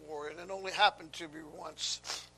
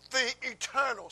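"eternal" pitched higher with emphasis; stress on eternal; 7 semitones higher, 6 dB louder than usual. Real, not acted. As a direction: lean on the word "eternal". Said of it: "I stress that word".

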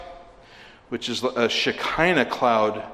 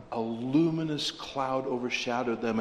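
hushed awe; 7 dB quieter than usual. Acted. Hushed reading after exclamation.

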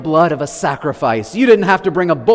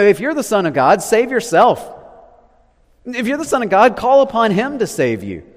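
higher taunting crowd voice; sneering taunt, higher and rising; mocking jeer; 7 semitones higher, 4 dB louder than usual. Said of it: "the crowd's mocking here".

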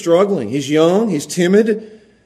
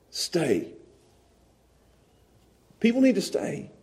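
normal talking voice

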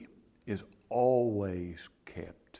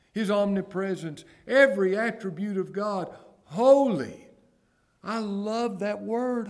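high, rising pulpit fervor; fervent preaching; 8 semitones higher, 4 dB louder than usual. Real, not acted. Preacher exalting God's holiness to the congregation, fervent. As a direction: build the list into a high, exultant cry.

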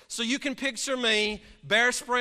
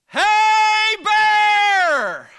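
loud high shout to scare a bear; yelling; 13 semitones higher, 13 dB louder than usual. Retold or imitated. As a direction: bellow it out, much higher and louder. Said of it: "yell out these two words".

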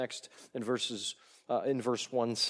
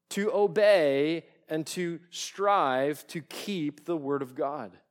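louder and slower, leaning into each phrase; building to a climax; 4 semitones higher, 7 dB louder, slower than usual. Real, not acted. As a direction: drive it home slowly and loud.

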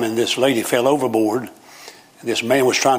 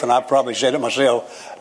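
usual speaking voice

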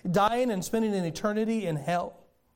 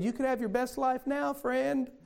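lightly voiced, syrupy smiling reassurance; smiling prosperity preacher; 5 semitones higher, slower than usual. Preacher mocks the roadside guy's Osteen-style line, smiling. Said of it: "with that Joel Osteen smile".

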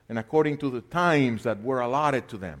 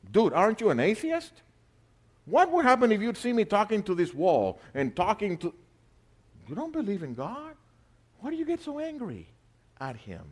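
incredulous retort; 8 semitones higher than usual. Real, not acted. Preacher mocking the atheist's contradiction, incredulous. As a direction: scoff at him in disbelief.

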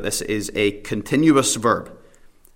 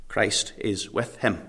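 hushed emphasis; 8 dB quieter, slower than usual. Real, not acted. Quiet emphatic point in sermon.

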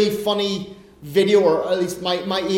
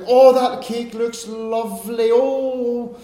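slow, hesitant mock refusal, lightly voiced; coy Irish lady; 3 semitones higher, much slower than usual. Second Irish lady tempted by cake, slow coy refusal. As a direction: drag out the polite no. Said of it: "the Irish lady was like".

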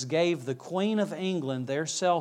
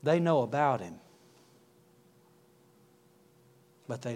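low, soft preaching with long pauses; solemn appeal; 5 semitones lower, 5 dB quieter than usual. Real, not acted. Preacher urges listeners to know God before prayer, hushed and solemn.

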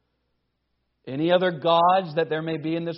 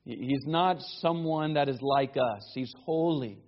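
usual speaking voice

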